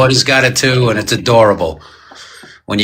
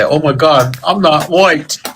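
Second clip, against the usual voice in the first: mock whine; 5 semitones higher, slower than usual. Mock quote of self-pity.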